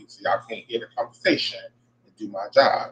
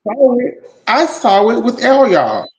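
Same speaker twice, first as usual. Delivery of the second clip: worked-up outburst; 12 semitones higher, 5 dB louder than usual. Real, not acted. Upset fan venting with cohost.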